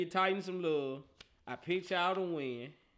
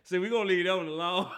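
ordinary talk, nothing marked

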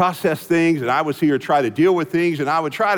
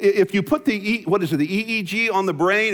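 ordinary talk

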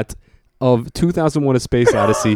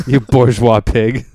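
mock insult; 3 semitones lower, 5 dB louder, slower than usual.

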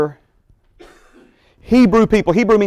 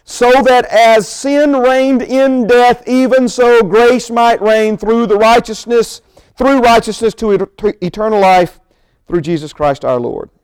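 raised, emphatic Bible reading; scripture reading; 4 semitones higher, 3 dB louder than usual. Acted. Preacher reading scripture to the congregation, emphatic. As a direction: read the verse out with driving emphasis.

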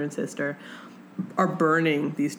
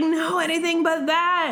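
higher, hammed-up desperate hero; mock anguished protest; melodramatic plea; 11 semitones higher than usual. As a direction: overplay the plea. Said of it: "and he's like".

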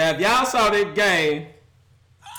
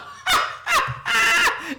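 shrieking burst of laughter; helpless high-pitched cackle; high squealing laugh. Real, not acted. Mocking the Raiders' collapse.

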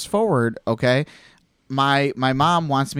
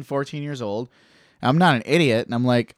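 ordinary delivery

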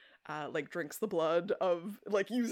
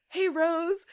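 cheery greeting; 10 semitones higher, 7 dB louder than usual. Retold or imitated. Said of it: "he's like".

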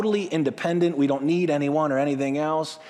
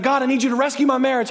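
higher, louder begging prayer; desperate plea; 7 semitones higher, 4 dB louder, faster than usual. Shown as a demonstration. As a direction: plead for rescue. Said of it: "How about instead of".

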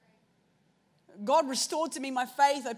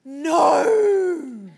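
a sudden loud, crying "No."; loud refusal; 2 semitones higher, 11 dB louder than usual. Retold or imitated. Refusing God's prompt at the cafe, loud crying no. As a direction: shout the no like a pained outburst. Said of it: "I was like".

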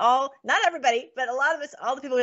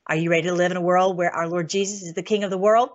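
normal talking voice

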